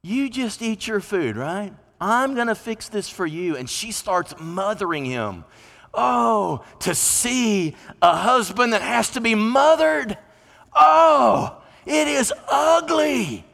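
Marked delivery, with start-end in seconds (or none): 0.0-3.6 mocking mothering tone. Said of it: "Jezebel mocks him". 5.9-13.4 loud, high cry of disgust; disgusted shout. Real, not acted. Preacher decrying a husband who must be mothered, loud and high. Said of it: "preacher's outburst".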